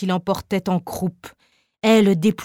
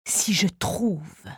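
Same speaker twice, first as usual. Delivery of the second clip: lower, softer, slow half-whispered musing; low first tone; 7 dB quieter, much slower than usual. Acted. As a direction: say it low and slow, almost to yourself. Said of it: "sur trois tons différents".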